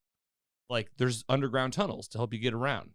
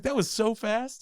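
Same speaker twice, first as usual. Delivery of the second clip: high, louder, drawn-out retold amazement; amazed reaction; 11 semitones higher, 5 dB louder, slower than usual. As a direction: exclaim it in amused disbelief. Retells amazed reaction to scene.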